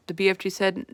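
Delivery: ordinary delivery, nothing marked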